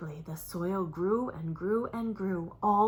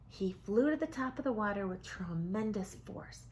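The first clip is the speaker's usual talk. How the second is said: ordinary delivery, nothing marked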